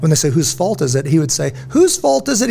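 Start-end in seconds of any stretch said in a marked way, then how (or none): 1.7-2.5 high-pitched pointed question; teacher's challenge. Retold or imitated. Teacher training SEALs to take the blame, high challenging question. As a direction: pitch it way up, quizzing the student.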